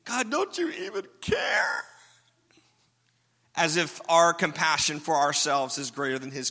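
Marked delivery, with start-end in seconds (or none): 0.0-1.8 high, slow, pleading mock cry; complaining to God. A believer crying out at God, high pleading complaint. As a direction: whine it up at heaven like a hurt child. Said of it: "Have you ever said that to God?".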